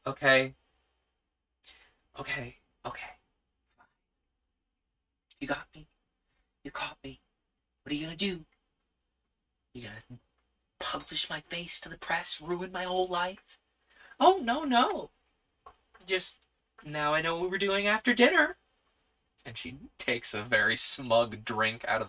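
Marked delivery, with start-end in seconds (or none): 0.1-13.6 hushed, halting admission of defeat; resigned surrender. Acted. Exposed hero asks date what she will do, quietly defeated. 14.2-18.6 high-pitched, playful dismissal; teasing reassurance. Acted. Date tells exposed hero her after-dinner plan, high and teasing.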